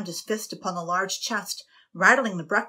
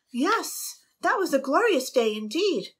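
higher, fond recollection; Annabel's voice; 8 semitones higher, 4 dB louder than usual. A narrator's reading. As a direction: gush fondly. Annabel reminiscing.

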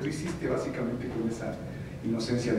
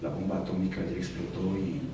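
Bruce Willis voice; 5 semitones lower, 4 dB quieter than usual. Retold or imitated. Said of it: "y le dice".

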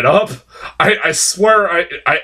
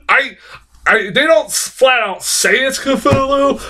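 excited; 10 semitones higher than usual. Real, not acted. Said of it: "I got all worked up".

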